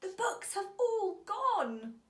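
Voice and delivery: high, loud, slow wail of dismay; child's cry. A narrator's reading. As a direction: let the boy's alarm ring out. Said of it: "cried Peter".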